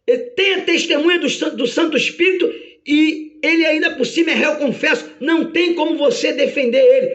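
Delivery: high-pitched, indignant protest; flustered, acted outrage; Satanás, bewildered. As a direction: object in disbelief to the defence lawyer. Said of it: "E Satanás, atordoado, diz".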